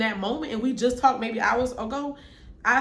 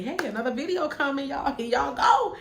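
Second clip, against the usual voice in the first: higher, hyped-up video plug; mock upbeat announcement; 5 semitones higher than usual.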